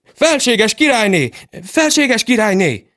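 high urgent calling out; excited. Acted. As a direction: call her in a hurry.

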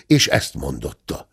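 usual speaking voice

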